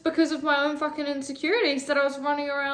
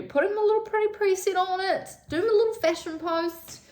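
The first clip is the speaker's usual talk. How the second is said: put-on a higher, sing-song girly voice; light higher mock influencer voice; 9 semitones higher than usual. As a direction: play up a cutesy influencer. Girly voice for fashion posting.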